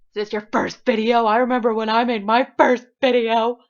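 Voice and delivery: loud, put-on taunting voice; hammed-up mockery, projected loud; mocking heckler. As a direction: jeer at a clueless beginner, loudly. Mocks herself in a put-on voice.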